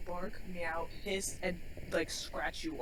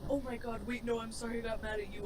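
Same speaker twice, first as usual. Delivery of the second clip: frantic apology; 4 semitones higher, 11 dB louder than usual. Retold or imitated. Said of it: "I would be like".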